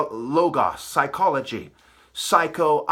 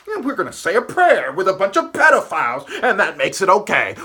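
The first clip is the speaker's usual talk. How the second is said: scornful mockery; 3 semitones higher, 5 dB louder than usual. Real, not acted. Scornful mockery of praying hypocrites.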